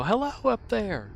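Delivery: high, loud call up to the power lines; yelling up. Retold or imitated. Man yelling up to crows on the power lines, high call. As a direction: call up to the birds, friendly and high. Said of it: "I'll yell up there".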